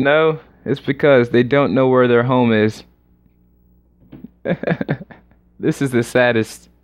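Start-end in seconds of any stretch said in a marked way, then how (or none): 4.2-5.0 soft laugh. Real, not acted. Laughs at the sad joke.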